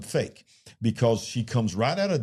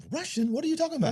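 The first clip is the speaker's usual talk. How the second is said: high, acted disbelief; incredulous denial; much faster than usual. Girlfriend questioned about speaking Russian, mock incredulity. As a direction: imitate someone utterly puzzled by the claim. She denies having spoken Russian.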